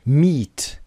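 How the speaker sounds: MEET with a long, drawn-out ee; long vowel. Shown as a demonstration. English teacher demonstrates the long ee sound, drawn-out. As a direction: stretch the vowel in میت. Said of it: "یه کشیده است".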